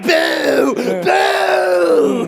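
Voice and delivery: high, loud, hammed-up jeers; mock Princess Bride booing. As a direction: wail the boos like a theatrical heckler. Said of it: "it's only turned into Princess Bride".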